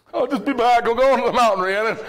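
a pretend husband slipping out with a light excuse; mock casual husband. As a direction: toss off the excuse as if sneaking away. Acting sneaking off to wife.

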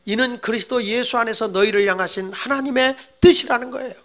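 normal talking voice

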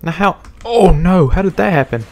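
high, loud startled cry; shocked outburst at a sudden fall; alarmed. Real, not acted.

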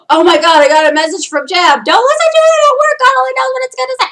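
high, loud hammed-up alarm; mock panic. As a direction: play the friend panicking over the message. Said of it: "I'm just gonna be screaming".

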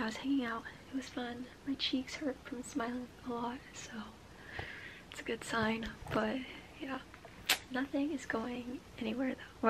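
soft, half-whispered confiding; hushed talk. Real, not acted. Vlogger packing at five A M, hushed talk. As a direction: keep it down, almost a whisper. Hushed vlog late at night.

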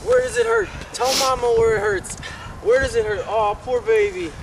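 put-on teasing friend babying the wounded boy; mock mama. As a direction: coo over him like a fussing mom. Mocking mother comfort.

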